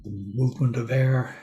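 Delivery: measured meditation guidance with long, silent pauses; guided meditation. Real, not acted. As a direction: leave space after each phrase, guiding listeners into body awareness. Leads a silent-day meditation.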